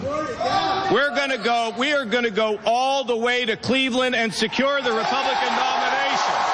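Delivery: shouting to the crowd; big rally shout, rising high; rally speech. Real, not acted. Rally climax, cheering crowd.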